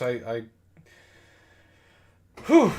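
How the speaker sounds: ordinary talk, nothing marked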